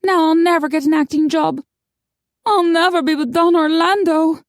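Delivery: high, loud lament; Maggie, despairing. A narrator's reading. Heartbroken woman lamenting to friends over drinks, high despairing voice. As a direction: wail it as all hopes lost.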